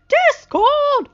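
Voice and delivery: very high, loud furious yell; angry yell. A narrator's reading. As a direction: yell his name in anger. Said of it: "they all yelled in anger".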